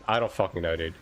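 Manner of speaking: usual speaking voice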